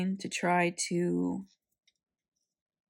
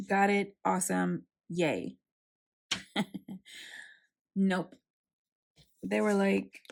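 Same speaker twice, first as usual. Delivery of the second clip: ordinary delivery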